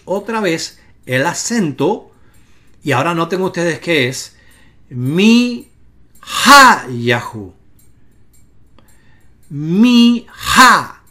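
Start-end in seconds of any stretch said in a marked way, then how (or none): 4.9-11.0 emphatic, syllable-by-syllable Mi-ha-yahu, accent on ha; stress on HA. Shown as a demonstration. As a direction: hit the HA in Mi-ha, demonstrating the moved accent. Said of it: "ahora el acento no es Mi-ha-yahu".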